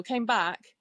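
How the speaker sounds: ordinary talk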